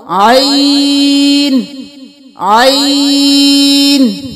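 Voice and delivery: عین from mid-throat with no glottal catch, drawn out very slowly; throat ain. Shown as a demonstration. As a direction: squeeze عین from the throat, long. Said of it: "گلے کے بالکل درمیان سے حمزہ کی آواز نہ آئے". (عین گلے کے درمیان سے، بغیر ہمزہ)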